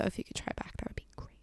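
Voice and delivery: sarcastic unvoiced whisper. Nudging her date to try harder, in a sarcastic whisper. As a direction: breathe it as a snide aside. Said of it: "I'm like".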